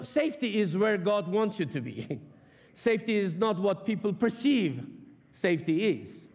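normal talking voice

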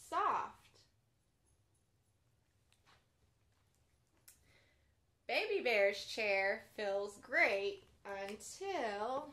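gentle bedtime storybook read; reading to children. A narrator's reading. Children's librarian reading a pop-up book aloud, gently.

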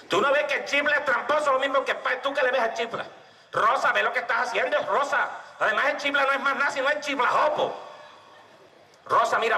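high nagging mother voice; scolding mother. Acted. Mother scolding Rosa about the chifle, high and nagging. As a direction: nag her daughter like a fed-up mum.

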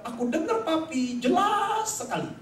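put-on high child voice, last words drawn out; reply brightly like a kid, stretching the key word; imitating a child's emphatic high reply; child's answer. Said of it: "Kemudian dia bilang".

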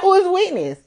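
high, incredulous question; mock-baffled, high-pitched; retold disbelief. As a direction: reenact total confusion, voice way up. Retold disbelief about a witness.

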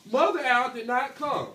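ordinary talk

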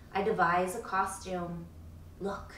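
plain reading voice

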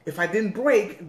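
usual speaking voice

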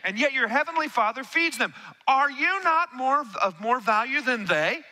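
measured, rising Bible reading; slow rising scripture read. Acted. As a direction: read the verse slowly and build. Reads scripture aloud.

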